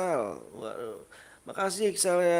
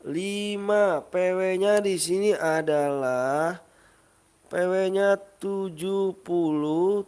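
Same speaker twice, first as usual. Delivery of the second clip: higher, louder drawn-out announcing; countdown announcer; 4 semitones higher, 4 dB louder, slower than usual. Real, not acted. Streamer counts to five and reveals the password, announcer-style. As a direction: call it out slowly so everyone catches it.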